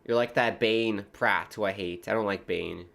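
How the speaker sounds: ordinary talk, nothing marked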